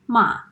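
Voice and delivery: "ma." in a sharp falling Mandarin fourth tone. Shown as a demonstration. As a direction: snap "ma." down fast like an annoyed no. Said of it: "The fourth tone is a dropping tone".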